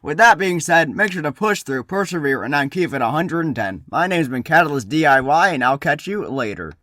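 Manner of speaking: loud, punchy vlogger intro; hyped DIY YouTuber. Acted. Catalyst DIY opening a craft video for his team, hyped. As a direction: pump up the viewers like a DIY host.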